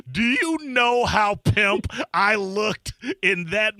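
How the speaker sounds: loud and slow, crowing about his own cool; loud proud brag. Real, not acted. Bragging to a radio host about a celebrity call, loud and slow. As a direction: boast it big, stretched out. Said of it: "proud brag, loud and slow".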